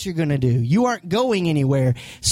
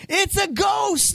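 scared cry; 12 semitones higher, slower than usual. Retold or imitated. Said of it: "and cried out in fear".

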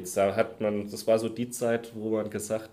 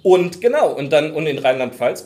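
suddenly much louder, overdriving the mic; 5 semitones higher, 14 dB louder than usual. Real, not acted. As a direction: burst out loud and high. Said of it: "für die Übersteuerung übernehme ich die Verantwortung".

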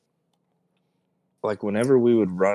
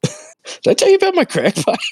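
mocking high voice. Mocking repeat of friend's line.

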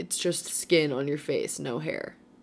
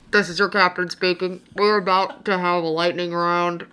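pilot announcement over the intercom; airplane captain voice; 5 dB louder than usual. Pretend. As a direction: speak like the captain on the PA. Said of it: "do my airplane voice".

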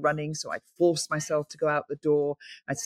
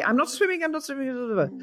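higher, quick "I'm not swimming" from a reluctant newcomer; insistent refusal; 7 semitones higher, faster than usual. Retold or imitated. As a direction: protest firmly and fast that you won't swim. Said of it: "she was very quick to say".

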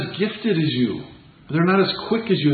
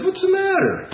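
higher, offhand mock Jesus; careless Jesus, imagined; 8 semitones higher than usual. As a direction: wave off the crying sisters lightly. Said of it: "That'd be kinda harsh".

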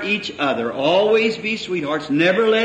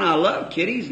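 normal talking voice

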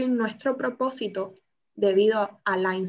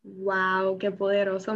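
usual speaking voice

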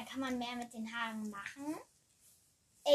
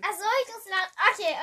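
louder; 7 semitones higher, 9 dB louder, faster than usual. Real, not acted. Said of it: "Du musst lauter reden".

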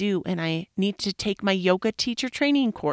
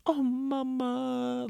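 put-on higher, softer, slow little-boy voice; imitating her sick son being cuddly; gentle mimic of her son saying mama; sweet child; 6 semitones higher, 5 dB quieter, much slower than usual. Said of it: "he was like".

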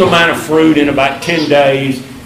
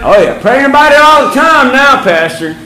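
mock eager; 9 semitones higher, 4 dB louder than usual. Fake eager reply to pastor.

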